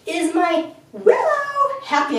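high, slow sing-song Willow. Shown as a demonstration. Trainer demonstrating a happy tone for the dog's name. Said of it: "We want that sing-song voice".

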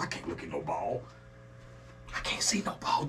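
hushed, as if afraid to look; whispered aside. Real, not acted. Whispers to himself, not wanting to see the screen. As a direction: drop to a breathy whisper.

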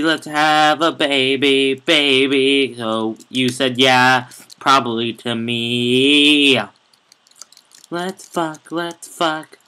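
loud mock romantic crooning; hammed-up lover. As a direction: ham up a cheesy seduction. Mock romantic serenade to admin.

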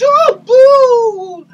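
loud pantomime boo at the fox; mock boo. Booing the fox.

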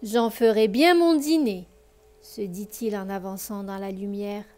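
put-on lively read-aloud voice for children; expressive bedtime story reading; story narration. A narrator's reading. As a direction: tell the tale warmly to a child listener.

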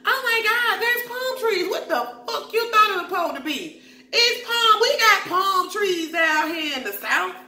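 high, mock-amazed imitation of the girls; exaggerated high gasping over palm trees; mocking the cast. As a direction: squeal it like a gushing tourist. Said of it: "they was like".

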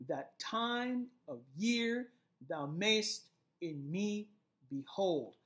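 that TIME of YEAR thou MAYST in ME beHOLD, slow and heavy on each beat; exaggerated iambic stress. Shown as a demonstration. Teacher showing how to read verse aloud, exaggerated iambic stress. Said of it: "this line would be read as".

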